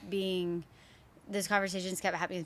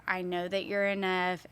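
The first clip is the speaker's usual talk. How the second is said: usual speaking voice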